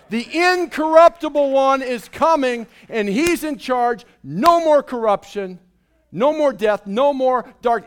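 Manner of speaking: loud, rising preacher's cadence; fiery preaching. Acted. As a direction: proclaim it to the whole hall. Preaching at full force.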